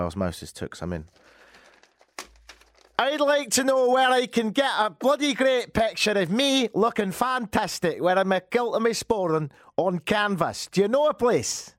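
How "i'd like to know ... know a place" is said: high, exaggerated Scottish customer; put-on Scottish accent. Customer asking where to get canvas pictures, put-on Scottish accent. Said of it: "Scotland's good".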